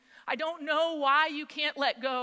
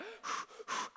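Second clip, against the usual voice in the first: short puffed exhales; mock labor breathing; imitated birthing breaths; 10 semitones higher, 9 dB quieter than usual. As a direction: mimic breathing-class puffs. Mimicking birthing class breathing.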